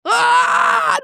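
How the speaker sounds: loud, high "Ah!" of sudden pain; high cry of shock, acted out; reenacted pain scream. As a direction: yelp as your back seizes. Reenacts back seizing up.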